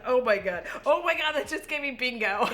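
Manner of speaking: ordinary delivery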